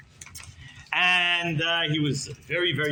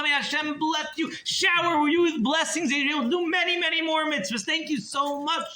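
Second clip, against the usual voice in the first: effusive praise; 12 semitones higher than usual. Acted. Rebbe overjoyed thanking the miser for a nickel, much higher. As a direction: marvel as if it were a fortune.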